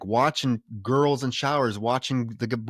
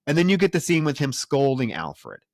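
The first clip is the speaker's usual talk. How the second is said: ordinary talk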